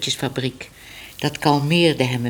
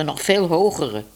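ordinary delivery, nothing marked

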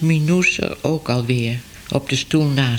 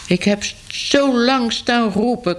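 complaining cat; 8 semitones higher than usual. Acted. Cat complaining to Minoes that it called long. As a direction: stretch it out like a sulky cat. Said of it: "klaagde hij".

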